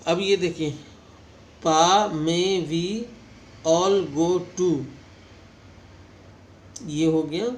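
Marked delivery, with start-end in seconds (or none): 1.6-4.7 very slow, every vowel stretched long; long vowels drawn out. Shown as a demonstration. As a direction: pull each vowel out long and loud. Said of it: "long vowel". (लंबे स्वर खींचकर, बहुत धीरे)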